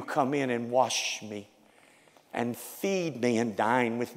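bowed, hushed repentance. Acted. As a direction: murmur it as a prayer. Voiced prayer of repentance.

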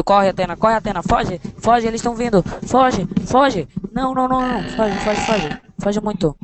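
high excited shouts to the cat; excited calling. Real, not acted. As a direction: cheer the cat on to run. Urging the cat to run.

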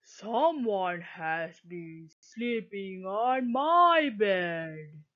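slow and heavy like a big papa bear. A narrator's reading. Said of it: "Then Papa Bear said".

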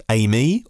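"aime" said AY-mee, rising at the end; two-syllable pronunciation. Shown as a demonstration. Host wonders how to pronounce the car's name, two-syllable pronunciation. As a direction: try out "aime" as AY-mee, questioningly. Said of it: "Do I pronounce it".

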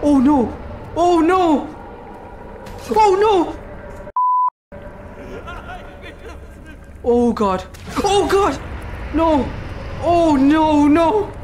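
real shocked wailing, pitch leaping up; horrified cries. Real, not acted. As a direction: cry out in escalating horror. Horrified by what happens onscreen.

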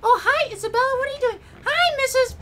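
put-on very high squeaky girl voice; cartoon kid falsetto. Girl greeting her crush's mom, squeaky.

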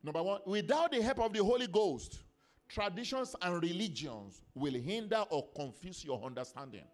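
loud, high, emphatic pulpit delivery; full-force preaching. Real, not acted. Preacher teaching the church about the Holy Ghost, full-force preaching. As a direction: drive each phrase home to the congregation.

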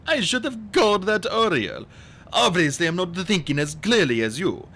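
falsely agreeable villain; smooth fake agreeableness. A narrator's reading. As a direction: agree smoothly to cover the scheme. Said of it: "answer smoothly".

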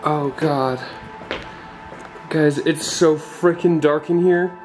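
ordinary delivery

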